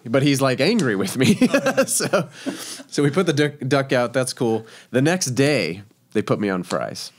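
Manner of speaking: ordinary delivery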